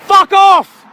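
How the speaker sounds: angry shout. Real, not acted. Man telling police to leave, shouting. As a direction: spit it out in rage.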